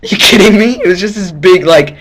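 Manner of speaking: high, loud indignant disbelief; mock outrage. Real, not acted. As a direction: squeal it out in fake indignation. Mock outrage at quick x-ray.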